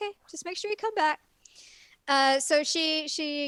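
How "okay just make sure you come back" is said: high-pitched hurried okay; lightly voiced worried friend; mock anxious plea. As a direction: plead half-jokingly, pitched up. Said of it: "I'm like".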